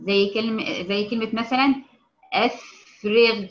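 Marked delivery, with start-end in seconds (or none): 2.3-3.4 أفرغ with a lightly heavy غ, slow; relative emphasis. Shown as a demonstration. Teacher demonstrating the غ after a kasra. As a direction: draw out أفرغ with a half-heavy غ. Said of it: "ساكنة بعد كسر".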